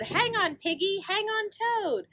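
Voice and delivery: rally them to hold tight; urgent. Acted.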